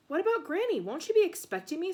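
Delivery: put-on a nervous boy asking his parents; anxious boy. A narrator's reading. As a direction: fret about Granny like a nervous boy. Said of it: "said Ben anxiously".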